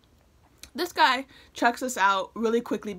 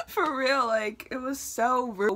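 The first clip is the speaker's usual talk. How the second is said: laughing; 4 semitones higher, slower than usual. Real, not acted. Laughing over rude treatment.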